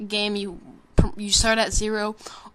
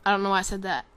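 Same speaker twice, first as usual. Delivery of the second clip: ordinary talk, nothing marked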